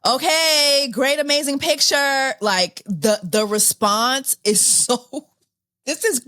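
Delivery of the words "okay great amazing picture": high, mock-cheery sarcasm; overly bright fake enthusiasm; sarcastic. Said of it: "The sarcasm".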